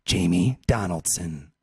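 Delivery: low, slow, solemn roll call; mock announcer. Host reading out the European team in mock announcer voice. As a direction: intone each name deep and deliberate.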